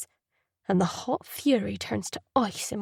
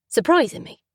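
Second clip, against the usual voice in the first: plain reading voice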